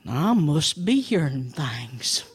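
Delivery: low, slow, whispery bear voice; slow-witted deep bear; puzzled bear. Acted. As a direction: mumble to yourself, deep and very slow. Bear talks to himself.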